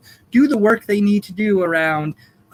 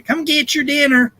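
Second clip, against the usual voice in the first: southern accent; 5 semitones higher than usual. Shown as a demonstration. Caretaker calling a chimp to dinner, southern accent. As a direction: put on a folksy southern accent. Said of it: "That was my southern accent".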